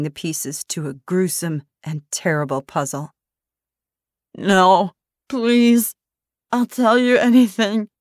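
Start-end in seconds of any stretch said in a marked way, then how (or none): 4.3-7.9 higher, louder pleading; desperate begging. Acted. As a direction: plead through pain. Daphne begging, injured.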